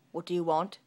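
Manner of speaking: loud, gruff gloomy challenge; guard glumly challenges the visitor; gloomy soldier. Acted. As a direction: grunt it at her gloomily. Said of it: "he asked gloomily".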